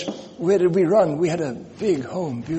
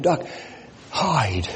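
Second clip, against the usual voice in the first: ordinary talk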